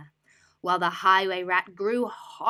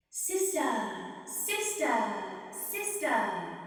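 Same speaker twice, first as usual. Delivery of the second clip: distant echo; 4 semitones higher, much slower than usual. Acted. Echo answering from the dark cave, distant. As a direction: let it fade back from the cave.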